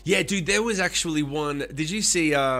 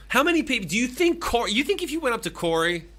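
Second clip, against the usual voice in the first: incredulous; 10 semitones higher, 4 dB louder than usual. Real, not acted. Incredulous rant, much higher and louder.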